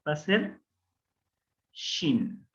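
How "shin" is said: shin said with a clear sh, a bit lower; shin sound. Shown as a demonstration. Teacher demonstrating the shin letter sound, sh. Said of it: "shin con el punto a la derecha".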